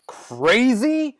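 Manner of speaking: high-pitched, incredulous repeat; outraged echo. Acted. Second, more outraged echo.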